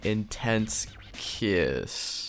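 slow hushed whisper for the kiss; mock-sultry hush; breathy whisper. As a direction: drop to a near-silent, slowed whisper. Reading steamy fanfic line.

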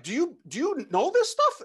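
high-pitched incredulous stammer; frantic questioning. Retold or imitated. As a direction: re-enact asking in a freaked-out squeak.

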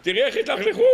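very high-pitched fake wail; hammed-up, squealing distress; mock hysterics. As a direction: cry out like a boy putting on a disaster. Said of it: "הוא לא יכול לדבר מרוב פחיות הוא".

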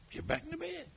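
pitched well up, sharp command; telling a drunk to go back to bed; retold firm order. To drunk Tony, high retold order to get back in bed.

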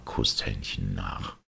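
ordinary delivery

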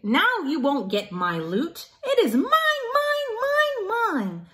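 hammed-up gloating yell; Pig shouting. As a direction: Pig on his toy pile, mock triumphant shout. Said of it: "shouted Pig".

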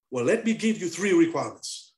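usual speaking voice

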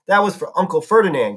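ordinary delivery, nothing marked

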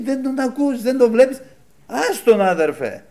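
high, loud indignant protest; retold angry brother. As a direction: imitate a riled-up man arguing back. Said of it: "Και νευρίασε ο αδερφός".

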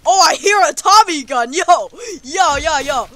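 high, loud burst of alarm; alarmed shouting. Real, not acted. Player hearing a Tommy gun, alarmed shouting. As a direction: shout a sudden warning, then rattle on.

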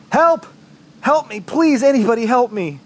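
high, loud desperate cry for help; urgent, high-pitched plea for rescue; calling for help. Acted. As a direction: shout out high to anyone who might hear. Injured narrator calls out for rescue.

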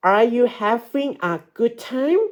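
"Are you having a good time?" lifting high on "time"; rising question tune. Shown as a demonstration. Teacher models a question for pupils, rising tune on "time". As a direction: raise the pitch at "time" as a question. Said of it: "ให้ออกเสียงสูงลูก".

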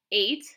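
"eight" punched as a sample ending; long A ending. Shown as a demonstration. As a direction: offer "eight" as the first option to try. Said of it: "you can try both".

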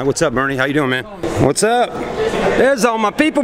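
usual speaking voice

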